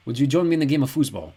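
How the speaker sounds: a hint of a Caribbean accent, not quite placeable; light not-quite Caribbean accent; Caribbean-ish accent. A narrator's reading. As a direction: speak it with a near-Caribbean accent. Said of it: "His accent made Kendra think of the Caribbean".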